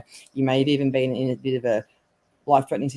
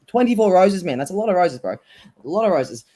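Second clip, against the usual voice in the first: louder, raised, amazed exclamation; 5 semitones higher, 6 dB louder than usual. Real, not acted. Psychic marvels at a dead friend's huge bouquet, excited. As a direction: marvel out loud at the big bunch.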